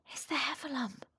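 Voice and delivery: frightened whisper to himself; anxious whispered thought; nervous whisper. Acted. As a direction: breathe it out, scared of the Heffalump. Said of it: "thought Piglet nervously".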